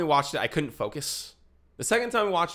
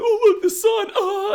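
very high, loud, silly mock exclamation; goofy kid; 10 dB louder, slower than usual. Kid trying to be funny in the theater, goofy imitation. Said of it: "like, goofy and funny".

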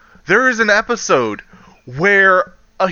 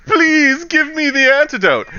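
mock pleading; 10 semitones higher than usual. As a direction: beg pitifully, putting it on. Fakes injury, begs for antidote.

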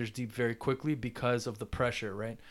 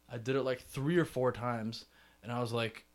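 normal talking voice

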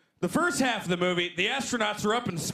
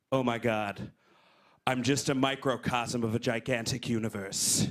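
lower, awed stoner musing; stoned nineteen-year-old; 9 semitones lower than usual. Retold or imitated. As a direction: marvel at the cosmos like a stoned teen. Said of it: "I'm like".

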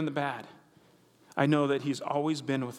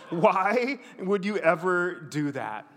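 imagined look; slower than usual. Retold or imitated. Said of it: "you got a great look right now of".

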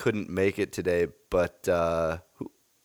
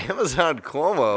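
laughing disbelief; 6 semitones higher than usual. Real, not acted. Host repeats in disbelief, laughing.

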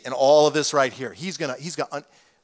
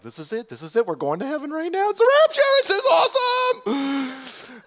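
climbing from hushed to a high loud cry of joy; building excitement, stretched out at the end; thrilled; 10 semitones higher, 3 dB louder than usual. Retold or imitated. Husband telling his scared wife the rapture is here, building to thrilled. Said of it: "I'm like".

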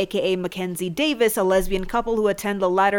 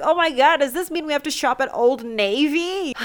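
higher, mock-horrified mom; mock dismayed mom; 6 semitones higher than usual. Mom hearing her kid is straight, mock horror. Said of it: "I'm gonna be like".

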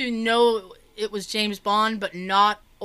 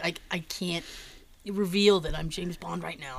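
low, hushed confession; confiding Roger Moore; 4 semitones lower, 6 dB quieter than usual. Retold or imitated. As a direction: quietly take someone into confidence. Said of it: "He's like".

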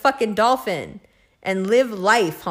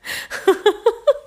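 a bright high giggle at herself; quick high laugh bubbling out; 10 semitones higher than usual. Real, not acted. Laughing at herself mid-rant, high giggle.

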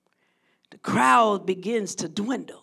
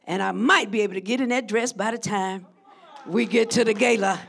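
ordinary delivery

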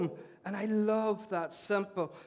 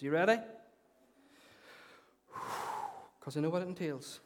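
normal talking voice

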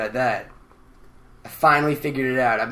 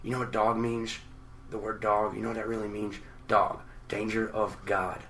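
quiet, lower, intense musing; Busey impression; 5 semitones lower, 11 dB quieter than usual. Busey explaining a word, low and hushed. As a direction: deliver like a wild-eyed guru. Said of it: "he'll be like".

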